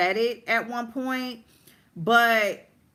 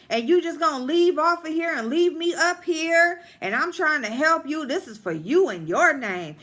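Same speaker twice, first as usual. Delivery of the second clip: angry retold rant; 5 semitones higher, 4 dB louder than usual. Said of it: "She was like".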